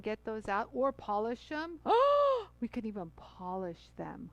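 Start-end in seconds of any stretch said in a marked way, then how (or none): 1.9-2.6 sharp, high excited gasp; sudden high gasp of delight. Real, not acted. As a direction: gasp at a bright idea. Delighted gasp at polishing idea.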